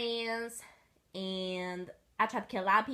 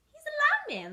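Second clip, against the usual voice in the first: high cooing; 4 dB louder than usual. Acted. Owner voiced.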